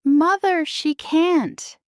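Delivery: high, startled outcry; shocked protest. A narrator's reading. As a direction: cry out in shock to mother. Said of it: "Anastasia and Drizella gasped".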